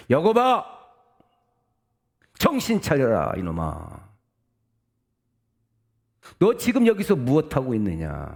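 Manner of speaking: loud, high shouted rebuke; God shouting. Acted. God calls Jacob to his senses, shouting. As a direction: cry out sharply, pitch falling away at the end. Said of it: "라고 소리치셨습니다".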